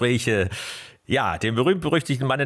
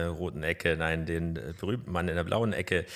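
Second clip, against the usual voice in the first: ordinary talk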